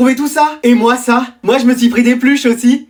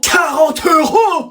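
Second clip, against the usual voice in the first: very slow shouted, higher pitch; shocked disbelief; 5 semitones higher, much slower than usual. Acted. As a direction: stretch out the disbelief. Mother shocked again.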